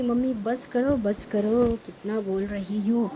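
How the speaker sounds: normal talking voice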